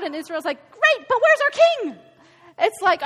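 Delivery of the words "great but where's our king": mock impatient Israelites. Israel mocked, demanding a king.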